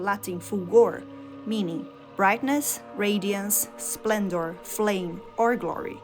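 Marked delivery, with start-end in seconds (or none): none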